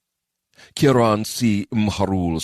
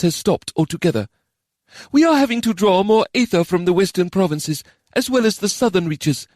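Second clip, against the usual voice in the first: formal official voice; 14 semitones higher, 4 dB louder than usual. Acted. Official reports to council.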